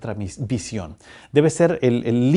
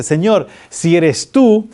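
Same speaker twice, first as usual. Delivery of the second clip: súplica actuada, lenta y fuerte; Pedro; 5 semitones higher, 5 dB louder, much slower than usual. Retold or imitated. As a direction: proclama el pedido alargando las palabras. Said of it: "Pedro responde y le dice". (voz alta y solemne, muy pausada)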